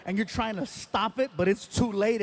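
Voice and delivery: ordinary talk, nothing marked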